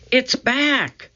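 high-pitched calling, moderate volume; excited call. A narrator's reading. Annie to her brother from the woods, calling excitedly. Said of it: "Annie called from the woods".

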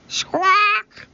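very high shrill bird screech; a sudden parrot cry; parrot squawk. A narrator's reading.

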